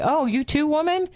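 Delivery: hammed-up cry of betrayal, like Caesar; betrayed Job. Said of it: "It's like".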